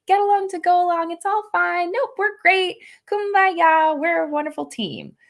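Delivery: high, bright sing-song fake cheer, everything's fine; sing-songy. Shown as a demonstration. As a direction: chirp it like a fake-happy team player. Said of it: "Sing-songy".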